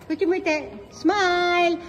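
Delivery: ordinary talk, nothing marked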